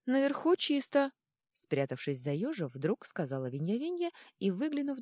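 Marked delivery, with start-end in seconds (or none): 0.1-1.1 high, loud sudden blurt; little girl's voice. A narrator's reading. Girl hiding behind the hedgehog, piping up.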